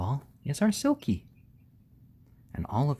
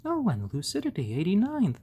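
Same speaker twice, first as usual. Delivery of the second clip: usual speaking voice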